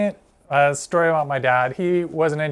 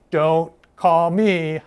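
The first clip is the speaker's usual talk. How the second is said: imitating dad; 4 dB louder, much slower than usual. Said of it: "He'd be".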